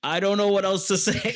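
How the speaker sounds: high, helpless trainee voice; flustered recruit. Retold or imitated. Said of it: "And then you're like".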